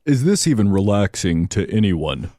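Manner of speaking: dopey deep voice; low and lazy; deepest voice. Pretend. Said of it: "talk in their deepest voice".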